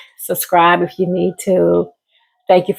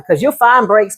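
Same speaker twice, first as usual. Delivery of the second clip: ordinary talk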